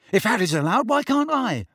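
high, rising complaint; shouted protest. A narrator's reading. Son objecting to being sent out, shouting. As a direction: protest it, climbing in pitch. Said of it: "shouted Ron".

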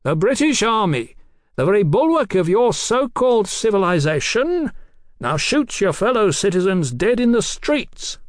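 high, projected, impassioned appeal; coaxing appeal. A narrator's reading. Captain appeals to the doctor's conscience, coaxing. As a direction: declaim it to win over an enemy. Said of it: "in a new and coaxing voice".

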